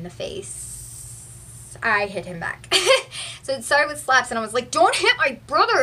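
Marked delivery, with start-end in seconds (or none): 4.7-5.8 retold angry shout at the bully, higher and louder; angry yell. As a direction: snap it out like a furious big sister. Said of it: "And I was just so angry".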